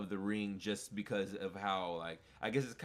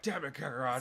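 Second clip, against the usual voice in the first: louder, higher mock-angry cursing of a rival; imitated anger; 5 semitones higher, 4 dB louder than usual. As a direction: curse your rival, hammed-up. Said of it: "He wasn't like".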